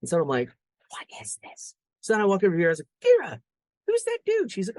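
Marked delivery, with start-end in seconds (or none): 0.9-1.7 retold baffled whisper; whispered disbelief. Dad puzzled by boy's cat offer, whispered aside. 3.0-4.5 retold dad calling, high and loud. Dad questioning his daughter about the boy, high mock-alarmed call.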